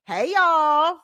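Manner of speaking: high, loud Southern hey y'all; Southern country accent, loud and bright. Shown as a demonstration. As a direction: drawl out a Southern country greeting. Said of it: "the little country accent come out of me".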